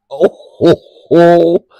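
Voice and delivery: loud laughing oh's; loud, helpless laughter. Real, not acted. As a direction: break up laughing. Bursting into laughter.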